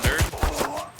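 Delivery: high, loud mocking nerd taunt. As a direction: tease him in a silly high voice. Taunting enemy in voice.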